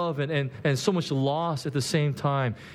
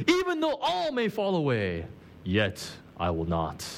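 Peter boasting; 2 semitones lower than usual. Retold or imitated. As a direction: play Peter swearing loyalty. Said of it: "And he says".

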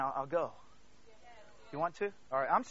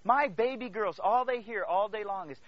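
normal talking voice

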